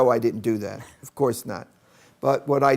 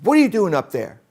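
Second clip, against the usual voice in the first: a bit higher and louder, demanding; stern teacher; 6 semitones higher, 4 dB louder than usual. Retold or imitated. Teacher questioning the third grader at the board, stern. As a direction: confront the kid at the board. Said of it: "She says".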